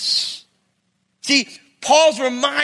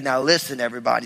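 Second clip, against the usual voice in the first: low, easy southern drawl; 10 semitones lower, 4 dB quieter, faster than usual. Retold or imitated. Pastor introducing a guest to his church, southern drawl. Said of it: "He's got a nice southern drawl".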